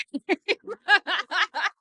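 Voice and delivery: real, high burst of laughter; self-conscious laugh. Real, not acted. Speaker admitting to the group she's also a matchmaker, self-conscious laugh. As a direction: laugh out loud, high and rippling. Amused at her own matchmaker reveal.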